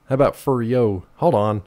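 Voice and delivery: ordinary talk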